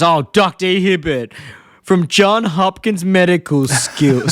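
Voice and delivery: slow, lightly voiced mocking dad; a hint of a sarcastic dad drawing out the credentials; Homer. Husband mocks family doctor to his wife, slow lightly acted. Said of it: "Homer goes".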